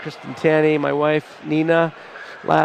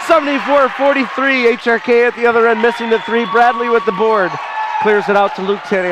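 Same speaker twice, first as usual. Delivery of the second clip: higher, louder, quick play-by-play; excited play-by-play; 6 semitones higher, 4 dB louder than usual. Real, not acted. Announcer calling the next play, still excited and fast. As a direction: ride the rush of the big shot.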